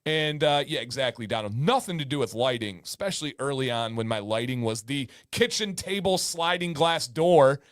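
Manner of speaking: usual speaking voice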